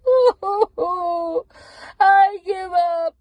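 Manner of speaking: a bear collapsing in tears; blubbering. Acted. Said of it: "he blubbered".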